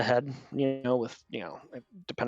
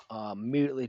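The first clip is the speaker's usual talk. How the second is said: ordinary talk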